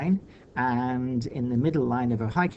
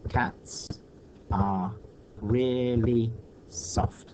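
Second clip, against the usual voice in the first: syllable by syllable; much slower than usual. Shown as a demonstration. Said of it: "how many syllables are there".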